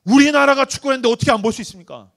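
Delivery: high, loud indignant protest; acting out an outraged soccer fan; indignant young churchgoer. Indignant youth confronting his pastor.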